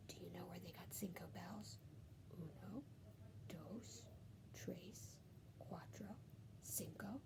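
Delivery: soft whispered counting; whispered aside, counting softly. Acted. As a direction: hush it to the child.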